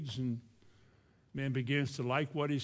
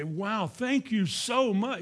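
slow, high, overdone gratitude, leaping up at the end; mock gushing thanks; 7 semitones higher, 5 dB louder, slower than usual. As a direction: gush it out like a fawning fool. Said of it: "You're not supposed to say".

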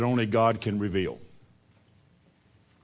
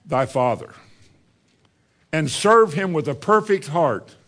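David charging his son Solomon, solemnly; preached scripture reading; 4 semitones higher, 4 dB louder than usual. Acted.

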